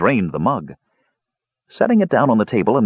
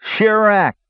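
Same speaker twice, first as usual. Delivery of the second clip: put-on much higher, drunkenly slurred; slurred spell word; 12 semitones higher, 3 dB louder than usual. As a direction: slur the magic word from the floor. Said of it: "he slurred".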